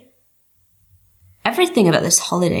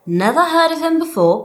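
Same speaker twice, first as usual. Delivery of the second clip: innocent fib; 8 semitones higher than usual. Acted. Lying after stalking online.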